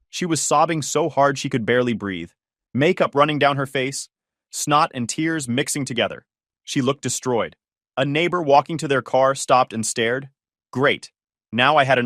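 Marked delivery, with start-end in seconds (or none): none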